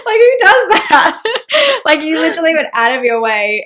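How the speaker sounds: squealing laugh through the words; real delighted high laughter. Real, not acted. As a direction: laugh it out, high and loud, amazed. Laughing at friend's kindness.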